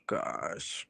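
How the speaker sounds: exhausted whispered sigh, then gosh; tired sigh. Real, not acted. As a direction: let the air out, drained, barely voiced. Worn out, sighing to herself.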